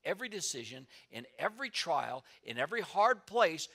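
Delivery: rising preacher's emphasis on hard. Real, not acted. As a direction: lift it with full preaching force. Preacher building to climax.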